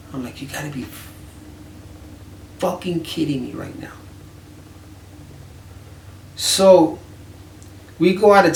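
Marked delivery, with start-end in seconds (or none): 0.4-3.9 hushed, breathless disbelief; disbelieving whisper. Retold or imitated. Whispering in disbelief at a friend who thinks no one would steal. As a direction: mutter it under your breath in shock.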